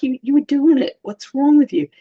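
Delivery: high mock scolding; incredulous, hammed-up telling-off; exasperated. Scolding Peter for sinking, mock exasperation.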